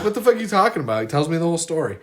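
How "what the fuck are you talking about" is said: incredulous. Retold or imitated. Retelling his own disbelief.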